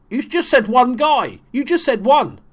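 high, loud, incredulous complaint; real indignant outburst; indignant protest. Real, not acted. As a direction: protest it loudly, pitch jumping up. Protesting at what the game said.

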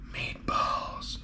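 soft unvoiced whisper. Real, not acted. Vlogger savouring the meatballs, hushed whisper. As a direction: whisper it like a secret treat.

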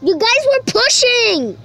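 high, loud complaint; indignant protest. Real, not acted. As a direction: shout your protest at the cheaters. Complaining about being pushed.

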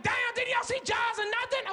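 high, slow, drawn-out disbelief; incredulous brother. Acted. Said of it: "My brother was like".

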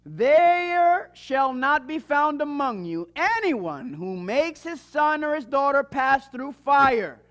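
emphatic scripture reading. Acted. Reads the scripture list aloud.

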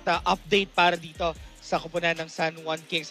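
ordinary delivery

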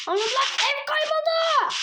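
high, loud alarmed outburst; panicked exclamation; shocked dismay. Real, not acted. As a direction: cry out as the house vanishes. Realises the house is lost.

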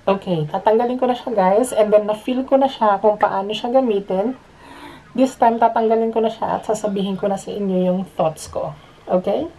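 muffled behind mask; speaking into respirator; through a respirator mask. Real, not acted. Seen 4: Half-face respirator covers his mouth and nose throughout the labelled words.

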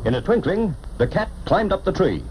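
usual speaking voice

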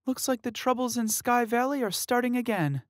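high, voiced-up uneasy remark; worried young woman. Emmy warning about old troubles, uneasy. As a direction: say it with foreboding.

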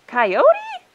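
very high, very loud "Coyote?"; astonished high-pitched yelp; shocked question. A narrator's reading. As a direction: squawk the name, swinging up. Coyote hears himself blamed.

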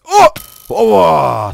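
loud outburst breaking off mid-word; startled shout. Real, not acted. Player caught mid-fight, startled shout. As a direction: burst into a loud drawn-out "ova".

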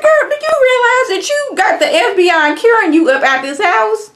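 high, loud disbelieving sass; incredulous girl. Retold or imitated. Viewer scolds Beverly, high loud and incredulous. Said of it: "I'm like".

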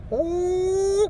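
high head-voice whoa. Shown as a demonstration. Showing the whoa taken into head voice, high. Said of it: "Into the head voice".

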